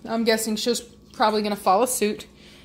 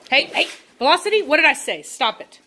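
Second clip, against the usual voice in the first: stern scolding; 6 semitones higher than usual. Real, not acted. Scolding a misbehaving dog.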